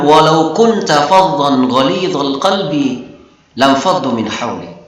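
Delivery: slow, measured Quranic chant; Quran recitation. Acted. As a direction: recite the verse slowly and melodically. Quran verse quoted in lesson.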